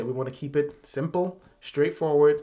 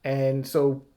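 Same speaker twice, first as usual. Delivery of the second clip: ordinary talk, nothing marked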